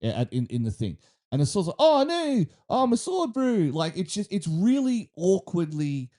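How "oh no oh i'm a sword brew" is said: put-on high-pitched New Zealand accent; Kiwi accent. Mocking a game's talking sword, hammed-up Kiwi accent. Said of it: "with the Kiwi accent".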